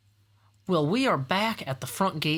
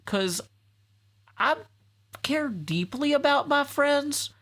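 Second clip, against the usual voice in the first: sincere; 11 semitones higher, slower than usual. Real, not acted. Earnest plea about friends.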